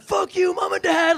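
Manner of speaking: high-pitched mock rage; raging. Raging in the car at parents, hammed-up anger. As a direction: act out a tantrum at the parents. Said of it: "raging in the car".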